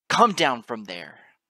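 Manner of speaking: loud, drawn-out angry order; growled command. A narrator's reading. Narrator shouting up at a goddess on her throne, growled. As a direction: roar it out like a growled challenge. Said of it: "I growled".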